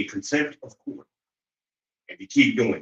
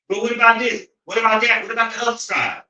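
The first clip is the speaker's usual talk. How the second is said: much higher, louder whiny mock protest; mocking whiner; 11 semitones higher, 5 dB louder, faster than usual. Mimicking people who deflect blame, a high whiny mock protest. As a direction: whine it like a kid dodging blame.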